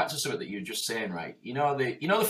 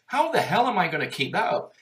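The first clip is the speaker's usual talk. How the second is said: usual speaking voice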